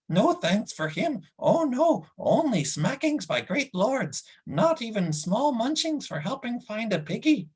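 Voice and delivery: a sulky beast complaining about no food; whining creature Gurgi. Acted. Narrator voices Gurgi.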